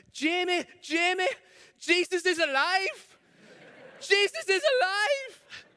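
put-on high, loud, slow shout of a thrilled girl; excited shouting child. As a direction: call across the playground to a friend. Girl calling out to friend.

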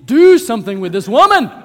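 loud, high, fed-up plea; exasperated appeal. Acted. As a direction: demand help, at the end of your patience. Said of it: "the disciples come to Jesus and say".

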